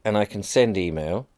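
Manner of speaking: usual speaking voice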